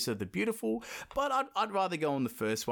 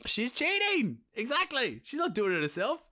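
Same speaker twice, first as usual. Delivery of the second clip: indignant referee; 13 semitones higher, 3 dB louder than usual. Real, not acted. Mock umpire ruling, pitch very high.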